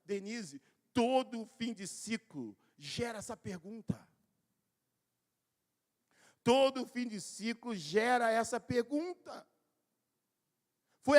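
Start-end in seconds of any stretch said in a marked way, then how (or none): 0.9-4.1 slow emphasis. Shown as a demonstration. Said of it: "repeated for emphasis". 6.4-9.5 slow emphasis. Shown as a demonstration. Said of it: "repeated for emphasis".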